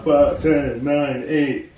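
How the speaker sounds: drawn-out, slow countdown. Retold or imitated. Retelling New Year's Eve, slow dramatic countdown. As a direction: count it down like a movie scene.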